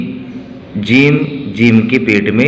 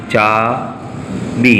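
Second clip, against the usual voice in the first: very slow, stretched चाबी; slow dictation; 3 dB quieter, much slower than usual. Shown as a demonstration. Teacher spelling चाबी for children, very slowly drawn out. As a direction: pull चाबी out at a crawl for dictation. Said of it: "हमको लिखना है".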